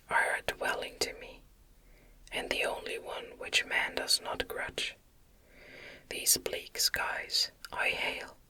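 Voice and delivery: plain reading voice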